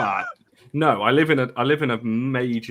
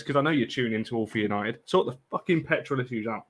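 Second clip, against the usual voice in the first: ordinary talk, nothing marked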